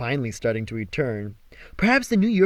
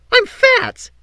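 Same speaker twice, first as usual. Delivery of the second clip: wailing; 9 dB louder than usual. Acted. Apollo wailing.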